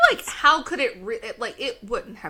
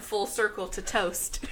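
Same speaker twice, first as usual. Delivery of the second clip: usual speaking voice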